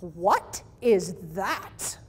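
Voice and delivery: a lightly voiced skeptical home builder, dragged out; unimpressed client. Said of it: "and he goes".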